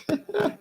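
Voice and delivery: short high-pitched laugh; petit rire. Real, not acted. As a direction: let out a brief, high chuckle. Rit d'un message du chat. (petit rire aigu)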